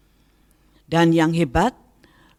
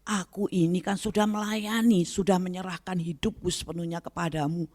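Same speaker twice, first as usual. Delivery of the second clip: complaining to God; 8 semitones higher than usual. Retold or imitated. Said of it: "saya agak marah".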